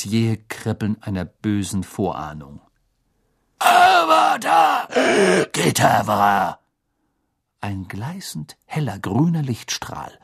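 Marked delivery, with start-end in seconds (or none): 3.6-6.6 high, loud, stretched-out spell cry; shouted incantation. A narrator's reading. Teacher casting the killing curse, drawn-out shout. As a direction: bellow the curse slowly.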